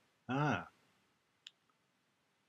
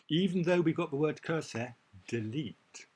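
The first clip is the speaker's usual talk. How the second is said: emphatic warning; 6 semitones higher, 6 dB louder than usual. Shown as a demonstration. Teacher warns against word matching.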